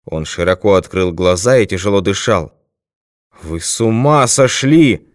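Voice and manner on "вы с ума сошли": drawn-out, raised-pitch cry of disbelief; man's higher, stretched-out exclamation; shouted outrage. A narrator's reading. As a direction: shout it slowly, incredulous. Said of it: "крикнул он".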